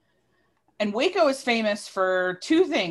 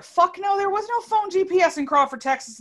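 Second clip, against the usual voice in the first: high, emphatic debunking rant; incredulous scoff; 10 semitones higher than usual. Real, not acted. Storyteller scoffing at her younger self, incredulous and high. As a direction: scoff at it, pitched way up.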